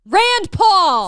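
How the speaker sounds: big excited shout. Real, not acted. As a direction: yell it out with joy. Cheering a candidate name.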